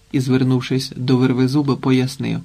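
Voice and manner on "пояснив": ordinary delivery, nothing marked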